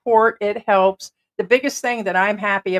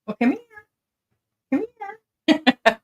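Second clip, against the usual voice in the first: calling the pet; 14 semitones higher than usual. Real, not acted. Calling the dog to come.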